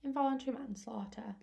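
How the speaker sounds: barely any voice left; losing voice. Real, not acted. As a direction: voice giving out, whispery. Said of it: "I'm losing my voice".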